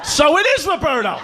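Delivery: high, accusing outburst; triumphant gotcha. Acted. Dad catching his son out, high triumphant accusation.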